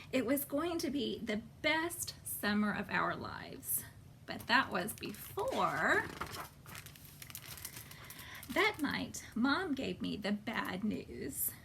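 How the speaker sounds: hushed storybook narration; whispered story reading. A narrator's reading. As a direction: storytime narration under the breath. Reading aloud to children, book text.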